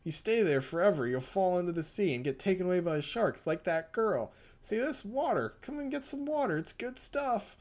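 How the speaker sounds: mock-sweet sinister wheedling; coaxing madman. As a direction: lure the cat in with fake kindness. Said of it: "It's like".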